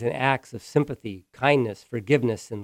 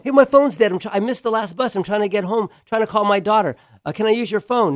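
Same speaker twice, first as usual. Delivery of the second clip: high, urgent pleading for help, louder; desperate woman pleading; 8 semitones higher, 4 dB louder, faster than usual. Retold or imitated. Stranded woman begs fast-food crew to use a phone, high and pleading. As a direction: beg like you're stranded and desperate. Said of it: "This woman was exasperated".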